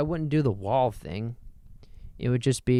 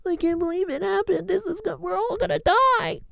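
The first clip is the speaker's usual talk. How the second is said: hammed-up panic, much higher, near tears; mock panic. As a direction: play a doom-scroller melting down. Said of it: "read a headline, and then it's like".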